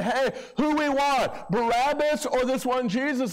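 lightly voiced ruler asking the crowd; mock crowd call. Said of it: "they say".

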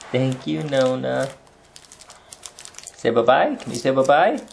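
normal talking voice

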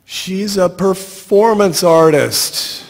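high, drawn-out yell. A narrator's reading. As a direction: call it slowly across a distance. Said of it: "I'm yelling".

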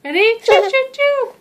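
high loud choo choo; train whistle call. Pretend. Train game for feeding baby.

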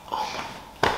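a hushed gasp of relief; stunned whisper. Retold or imitated. Hearing the phone is at the police station, a stunned whisper. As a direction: breathe it out in a soft whisper.

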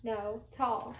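ordinary talk, nothing marked

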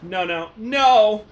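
loud, slow drawn-out no no no; anguished shout. Acted. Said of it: "I shouted to the sky".

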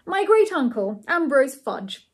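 ordinary delivery, nothing marked

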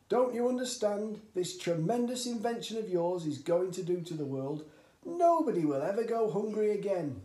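high, loud, working himself into a frenzy; worked-up dad. A narrator's reading. Father working himself up before his son, high and loud. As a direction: sell the world-changing dream.

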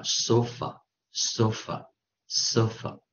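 sofa, sofa, sofa the way western Americans say it, slow. Shown as a demonstration. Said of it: "西部的人啊".

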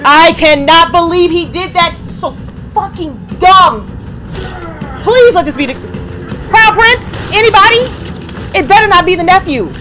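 high, incredulous pleading; exasperated disbelief. Real, not acted. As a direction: wail at the screen in disbelief. Pleading with the show's plot.

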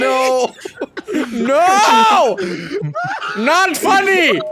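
loud, high laughing protest; real laughing outcry; laughing shout. Real, not acted. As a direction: yell through laughter that it's not funny. Laughing protest at dark joke.

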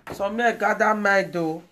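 loud, disgusted rant about the father; indignant outburst. Real, not acted. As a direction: lash out at the father who just turned up.

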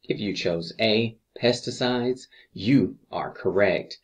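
normal talking voice

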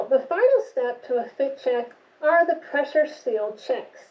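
muffled behind mask filters; speaking inside half-face respirator; through a respirator mask. Real, not acted. Seen 4: Respirator covers nose and mouth in every frame; reference frames unmasked.

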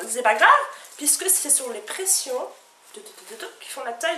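pitching the skirt to viewers like a teleshopping host. Acted. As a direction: sell it like a TV shopping host. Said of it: "j'ai l'impression d'être en téléachat".

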